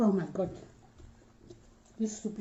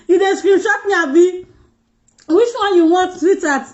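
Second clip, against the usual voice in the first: higher and louder teasing call-out; playful teasing; 6 semitones higher, 5 dB louder than usual. Real, not acted. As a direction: tease the screenshotter, loud and high. Teasing a viewer who screenshots.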